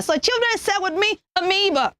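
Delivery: ordinary talk, nothing marked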